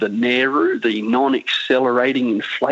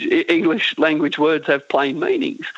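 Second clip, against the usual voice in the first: normal talking voice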